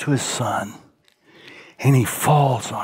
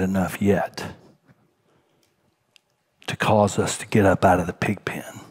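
whisper; 8 semitones lower, 4 dB quieter than usual. Real, not acted. Preacher whispering to his congregation about the pig pen. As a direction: whisper it, unvoiced and close. Preacher drops to intimate close.

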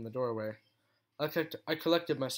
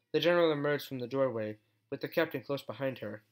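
ordinary delivery

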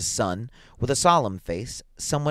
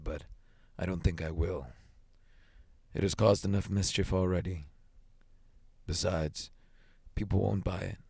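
lightly voiced grave soldier, slightly lowered; sergeant major, reluctant; 2 semitones lower, 4 dB quieter than usual. A narrator's reading. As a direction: explain it wearily, with long pauses.

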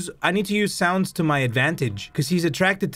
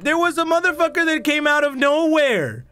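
high-pitched, alarmed disbelief; startled and incredulous; shocked outburst; 10 semitones higher than usual. Real, not acted. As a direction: cry out in real shock. Shocked after sudden attack.

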